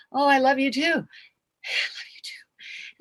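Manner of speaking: high, then fading to a slow, airy whisper; breath taken away. Acted. Answering a declaration of love, breath taken away. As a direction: let the breath run out, overcome. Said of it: "it's taking my breath away".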